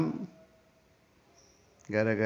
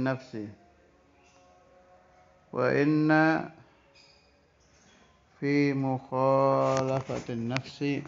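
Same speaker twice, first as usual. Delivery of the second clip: slow, raised Arabic reading voice, drawn out; kitab recitation; 2 semitones higher, slower than usual. Acted. Teacher reading the Arabic kitab to students, slow raised recitation. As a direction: intone the Arabic text like a pesantren teacher.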